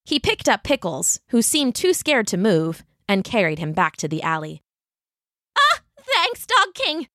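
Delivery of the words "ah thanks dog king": high squeaky little dog; small dog voice. A narrator's reading. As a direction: squeak it out, tiny and grateful. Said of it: "said Pickles".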